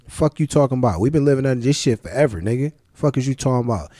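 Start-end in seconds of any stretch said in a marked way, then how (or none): none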